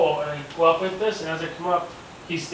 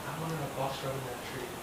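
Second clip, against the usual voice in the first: hushed aside; 2 semitones lower, 13 dB quieter, faster than usual. Retold or imitated. Whispered thought while hunting.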